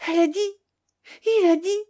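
high, loud, broken-off outburst; a frightened boy whose words stick in his throat; choked anguish. A narrator's reading. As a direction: blurt it out high, in panic. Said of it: "les mots s'étranglèrent dans sa gorge".

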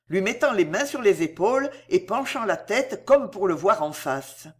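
usual speaking voice

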